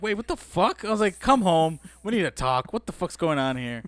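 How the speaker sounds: high, loud acted disbelief, easing down by the end; alarmed reaction. Calling his girlfriend home after pregnancy news, acting alarmed. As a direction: demand answers in retold shock. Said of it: "that was my reaction".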